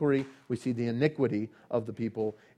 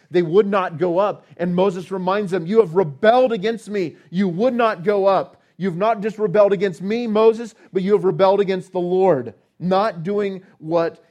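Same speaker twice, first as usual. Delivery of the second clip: loud, high rebuke in Moses' words; preaching at full force; 6 semitones higher, 8 dB louder than usual. Acted. Preacher voicing Moses rebuking the rebels, loud and forceful. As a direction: thunder the rebuke from the pulpit.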